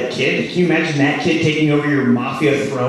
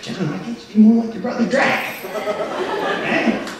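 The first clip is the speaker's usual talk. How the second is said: higher, nagging parent voice; nagging dad; 7 semitones higher than usual. Pretend. A dad at dinner scolding John, higher and nagging. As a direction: compare the kid to his star brother. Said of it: "you're just like".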